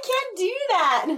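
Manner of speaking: squawky high duck voice attempt. Shown as a demonstration. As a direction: try a Donald Duck squawk. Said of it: "I can't talk like that".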